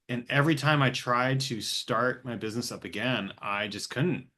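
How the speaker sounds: normal talking voice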